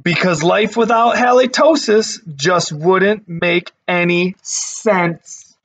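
put-on lively read-aloud voice for kids; storybook narration. A narrator's reading. Teacher reading a picture book to her class, lively storytelling.

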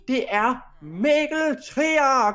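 slow, drawn-out announcer call; big show-host build on the name; stage introduction. Acted. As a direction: announce the star to the audience. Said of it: "jeg skal introducere dig jo".

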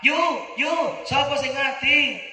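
high-pitched, urgent village question; calling a neighbour. Acted. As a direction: call out to find who died. Neighbour calls out after announcement. (niru tangga takon, swarane dhuwur)

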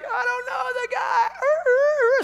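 high, slow wailing shouted denial; crying denial. Pretend. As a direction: wail the denial like a crow. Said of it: "Peter's like".

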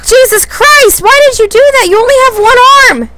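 high, loud frantic yelling; panicked yelling. Real, not acted. Player yelling at the creature attacking him. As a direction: yell in real panic. Attacked by one-armed enemy.

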